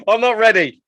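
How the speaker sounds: high and loud through laughter; laughing. Real, not acted. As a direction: blurt it out mid-laugh. Laughing off a touchy topic.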